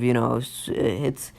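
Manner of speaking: ordinary talk, nothing marked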